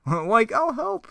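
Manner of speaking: high, slow cartoon slacker voice; Shaggy. Shaggy offering Fred help, high stretched put-on voice. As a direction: draw it out up in a squeak. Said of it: "Shaggy's like".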